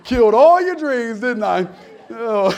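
loud gleeful tease, breaking into laughs; laughing. Real, not acted. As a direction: crow it through laughter, drawn out. Laughing at teenagers' spoiled belief.

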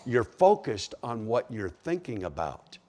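ordinary delivery

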